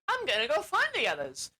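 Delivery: a hint of a brave young heroine; determined unicorn. Sad unicorn resolves to leave.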